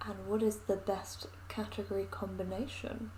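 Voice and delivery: soft unvoiced whisper. Real, not acted. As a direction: whisper the question. Drops into a whisper.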